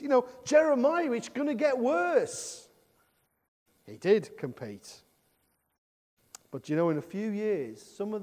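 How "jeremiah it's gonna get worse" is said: higher, louder warning call to Jeremiah; God warning. Acted. God warning Jeremiah it will get worse, higher and louder.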